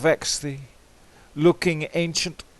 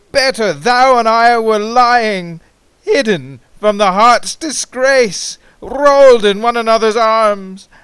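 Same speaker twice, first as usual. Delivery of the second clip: rising, louder lament of lost love; anguished declaration, louder and higher; passionate outcry; 4 semitones higher, 6 dB louder than usual. Acted. Passionate outcry in the recited poem.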